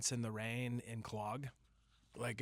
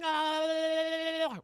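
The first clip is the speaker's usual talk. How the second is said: put-on high, loud kid's shriek; excited kid yell; 7 dB louder than usual. To his wife, imitating playground kids with a loud shriek. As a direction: shriek with joy. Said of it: "you're just like".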